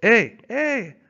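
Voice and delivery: high, loud gleeful shout; Francois cheering Buck, loud gleeful French-Canadian cry. Acted. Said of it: "Francois cried, slapping his thighs gleefully".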